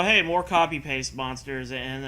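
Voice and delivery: ordinary talk, nothing marked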